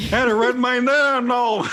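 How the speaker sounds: high, loud mock American protest; mock outraged shout. Acts outraged American at British actors.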